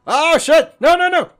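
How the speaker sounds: loud, high panicked yelling; panicked shout. Real, not acted. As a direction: yell in real panic. Panicked outburst in game.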